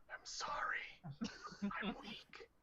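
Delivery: breathy, pleading whisper, voice fading; weak whisper. Acted. Player whispers the prisoner's plea to Paul, weak and pitiful. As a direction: whisper it like a broken prisoner begging.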